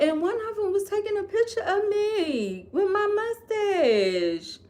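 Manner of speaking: a mother retelling a train insult in a high voice; imitating mom. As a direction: mimic mom's indignant complaint. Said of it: "she was like".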